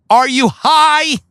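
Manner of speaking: high-pitched, drawn-out question; mock incredulity. Comic questioning someone's sobriety, mock incredulity. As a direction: stretch it out in hammed-up disbelief.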